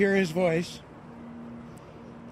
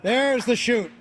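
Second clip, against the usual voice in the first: excited; 5 semitones higher, 4 dB louder than usual. Real, not acted. Commentator sees parachute open.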